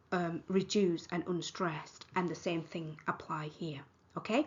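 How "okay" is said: ordinary talk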